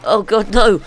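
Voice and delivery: startled gamer yell; alarmed cry. Real, not acted. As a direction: cry out in sudden dread. Player alarmed by sudden bad turn.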